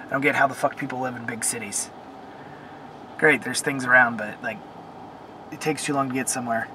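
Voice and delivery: usual speaking voice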